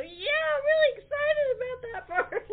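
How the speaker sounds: high, loud, laughing excitement; gushing with glee, laughing; mock eager. Telling Ray she's thrilled to hand it over, laughing. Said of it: "I go".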